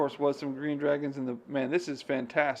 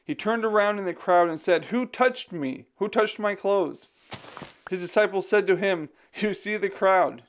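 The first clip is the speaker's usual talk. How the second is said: emphatic, raised scripture reading; Bible reading aloud; 5 semitones higher, 3 dB louder than usual. A narrator's reading. Streamer reads the Bible story to viewers, expressive narration. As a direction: read the verses out clearly and expressively.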